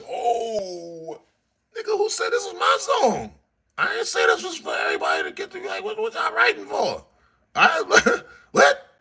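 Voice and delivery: high, exaggerated offended squeal; mock the copycats with outraged disbelief; indignant mock. Mocking the rappers who now want in.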